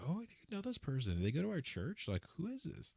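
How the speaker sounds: high, soft and fast, half-whispered; excited hushed quote. Retold or imitated. Recalling spotting someone familiar in Target, high hushed excitement. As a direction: gasp out a quick hushed guess.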